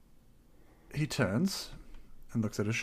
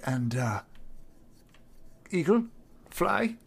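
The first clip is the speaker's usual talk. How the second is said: polite adventurer; 5 semitones higher, 8 dB louder than usual. Acted. Player voices his character.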